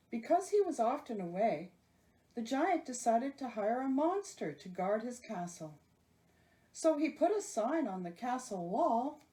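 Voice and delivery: ordinary delivery, nothing marked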